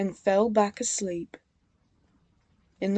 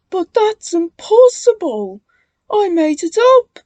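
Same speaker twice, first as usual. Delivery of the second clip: high, loud frightened stammer; 10 semitones higher, 10 dB louder than usual. A narrator's reading. Bill scared of the ghost, stammering high. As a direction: stumble over the words in fear. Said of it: "stammered Bill".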